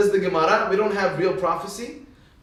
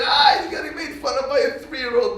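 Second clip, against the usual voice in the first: mocking boys' shout; 8 semitones higher than usual. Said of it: "all the other boys were like".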